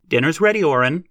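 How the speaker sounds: loud, high call from the porch; mother calling her son in for dinner, loud and high. A narrator's reading. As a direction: call him in to dinner from afar.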